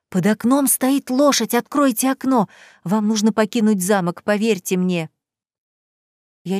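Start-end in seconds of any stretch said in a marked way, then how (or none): none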